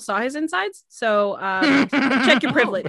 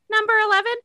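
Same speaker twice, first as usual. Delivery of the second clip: drive-thru worker voice; 10 semitones higher, 3 dB louder, faster than usual. Retold or imitated. Drive-thru lady voiced, high.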